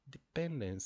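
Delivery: "Dependence" as de-PEN-dence, high PEN; stress on second syllable. Shown as a demonstration. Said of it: "it's not like hospital".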